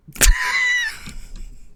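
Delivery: high shrieking burst of laughter. Real, not acted. Cracks up at the quote.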